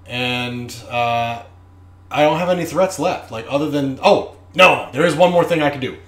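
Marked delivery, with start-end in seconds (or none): 4.0-6.0 high, loud, fast sudden idea; sudden realization. Real, not acted. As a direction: catch yourself with a new idea. Remembers one more threat.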